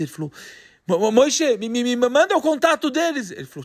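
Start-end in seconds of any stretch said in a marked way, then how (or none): 0.9-3.3 disbelieving stammer on Mo-, mo-. Acted. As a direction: stutter in disbelief. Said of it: "O F não acredita". (gaguejando sem acreditar)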